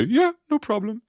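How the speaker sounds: high, slow, mock-carefree yeah no problem; a hammed-up high carefree reply; mock easy reply. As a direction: squeak it out like a mock breezy answer.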